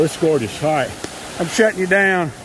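ordinary talk

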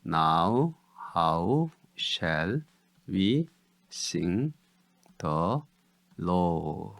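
"Now, how, shall, we, sing, the, 로", each apart; syllable by syllable. Shown as a demonstration. Teacher gives syllables of "Now how shall we sing the 로", separately. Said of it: "음절은요".

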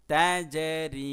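তাজরি drawn out very slowly and loud, জ jolted; তাজরি intoned slowly with a bounce on জ; qalqala demo. Shown as a demonstration. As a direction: recite তাজরি with a push on জ. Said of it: "ধাক্কা দিয়ে".

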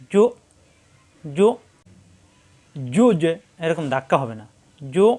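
জু held in the throat, no bounce; hard stop. Shown as a demonstration. Teacher showing জু caught in the throat. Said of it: "গলার ভিতরে আটকে যাবে".

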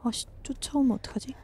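anxious whisper; panicking gamer. Real, not acted. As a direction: whisper it scared of being chased. Fearing pursuit in horror game. (겁에 질린 속삭임)

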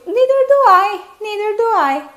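neither do I sung out high and bright. Shown as a demonstration. Teacher acting the friend's reply to students, in a high voice. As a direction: lift the reply well up in pitch, like a cheerful agreement.

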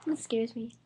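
scared whisper in the dark. Real, not acted. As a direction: breathe it, no voice.

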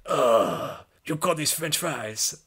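slow, sniffy mock French accent, "French fries" sneered; hammed-up French accent scoffing; mock French disdain. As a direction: play a snooty Frenchman unimpressed by the fries. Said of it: "he's like".

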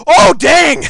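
high, loud outburst; explosive amazed shout; shocked shout. Real, not acted. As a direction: yell out at a sudden surprise. Sudden shock in game.

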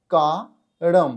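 "কারম" very slowly in two parts, kaa, then ram; final m sound. Shown as a demonstration. Teacher explaining the ending pronounced as m, slow broken-up pronunciation. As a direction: split "কারম" and close firmly on the m. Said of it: "তাহলে সেটা ময়ের উচ্চারণ হবে".

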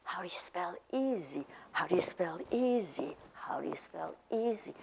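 whispered drill, same rhythm. Shown as a demonstration. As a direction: whisper the little song. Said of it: "heard all different kinds of ways".

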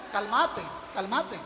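soft, low, calming; soothing the soul. Shown as a demonstration. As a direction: hush your own soul gently. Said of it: "digámosle al alma". (susurro tranquilizador, más bajo y suave)

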